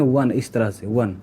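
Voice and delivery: वन said as "wun", starting with a w sound; pronouncing one. Shown as a demonstration. Teacher showing students वन begins with a w sound. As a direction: say वन slowly like "won", w first. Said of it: "यहाँ इसका उच्चारण क्या करते हैं?".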